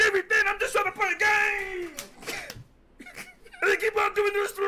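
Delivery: high, strained fake-crying yell through laughter; mock wailing. Gamer fake-sobbing at the screen, hammed-up crying shout. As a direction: wail like a kid who keeps getting interrupted.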